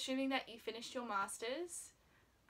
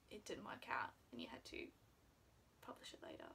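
hushed, fast whisper; 4 semitones lower, 10 dB quieter, faster than usual. Real, not acted. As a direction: whisper the worry gently. Voorzichtig fluisteren over tegenvaller.

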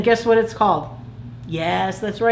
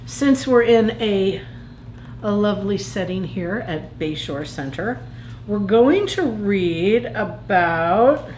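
plain reading voice

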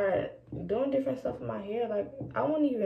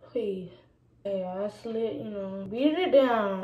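ordinary talk, nothing marked

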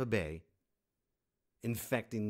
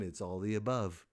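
ordinary talk, nothing marked

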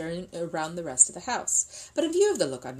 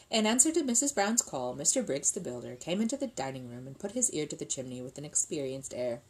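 plain reading voice

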